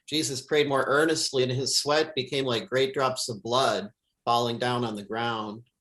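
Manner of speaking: normal talking voice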